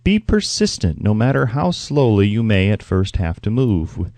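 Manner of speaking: ordinary delivery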